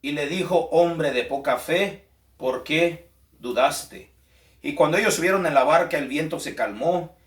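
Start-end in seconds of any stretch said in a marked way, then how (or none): 0.7-4.1 slowed, gently reproachful quote; measured reproach, lightly voiced; slow rebuke. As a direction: say it as Jesus rebuking Peter, unhurried.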